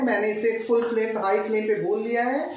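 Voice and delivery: ordinary delivery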